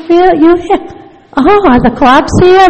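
comically retold paranoid alarm. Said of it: "Then I got paranoid".

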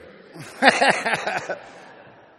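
a high, loud burst of laughter. Real, not acted. The speaker laughing at his own story, loud laugh.

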